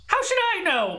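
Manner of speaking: high, indignant little-character voice; Little Jimmy. Acted. Small character asked where they went, high indignant retort. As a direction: pipe up high in protest. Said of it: "little Jimmy?".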